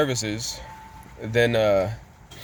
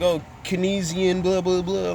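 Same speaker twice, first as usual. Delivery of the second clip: higher, droning mock of economics jargon; dismissive mocking; 6 semitones higher than usual. Mocking economists who keep going on, dismissive singsong. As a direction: parrot the tiresome critics in a raised voice. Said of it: "keep going with their stupid old economic".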